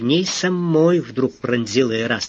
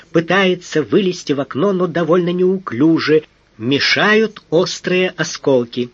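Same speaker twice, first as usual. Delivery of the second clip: ordinary delivery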